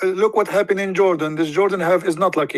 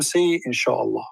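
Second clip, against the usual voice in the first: slow, lower, softer deadpan command; punchline; 3 semitones lower, 4 dB quieter, slower than usual. Retold or imitated. The Prophet deflating the eager followers, slow and low. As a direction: drop the letdown flatly and slowly. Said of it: "he said to them".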